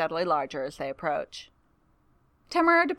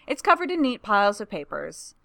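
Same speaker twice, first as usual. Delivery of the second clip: ordinary delivery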